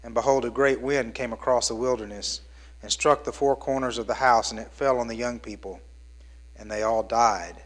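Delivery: low, hushed Bible reading; solemn scripture reading voice. Acted. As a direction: read the passage gravely and softly. Scripture reading before sermon.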